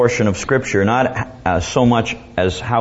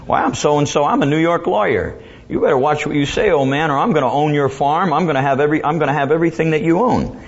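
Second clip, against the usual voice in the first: higher, pushy big-city lawyer voice; arrogant big shot lawyer; 6 semitones higher, faster than usual. Retold or imitated. New York lawyer threatens the old farmer, higher and arrogant. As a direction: puff up like a bully lawyer making threats. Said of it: "And the lawyer said".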